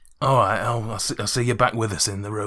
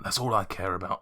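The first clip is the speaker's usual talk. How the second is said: ordinary delivery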